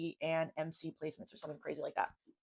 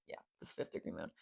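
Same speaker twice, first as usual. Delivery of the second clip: secretive whispered aside; 13 semitones lower, 13 dB quieter, faster than usual. Shown as a demonstration. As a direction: breathe it like a secret. Dramatic whispered repeat.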